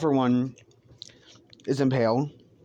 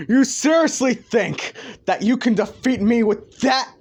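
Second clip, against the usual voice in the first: high, loud incredulous mockery; scornful villain; 11 semitones higher, 6 dB louder than usual. All For One scoffing at Deku's armor, loud derision. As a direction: crow it out straight after the laugh. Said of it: "just laughing like".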